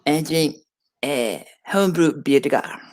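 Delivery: put-on slow, halting French accent.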